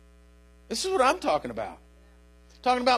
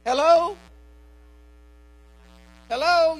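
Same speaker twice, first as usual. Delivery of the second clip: rising, loud Hello to the crowd; preacher's loud call to the congregation; 5 semitones higher, 7 dB louder than usual. Real, not acted. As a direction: call out to wake them up.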